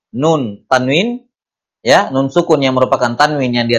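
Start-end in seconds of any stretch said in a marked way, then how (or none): none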